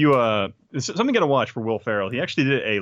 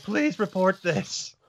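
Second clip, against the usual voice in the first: high, slow, drawn-out mock plea; Will Ferrell; 9 semitones higher, much slower than usual. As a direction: act out a comic dying request. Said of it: "He'd be like".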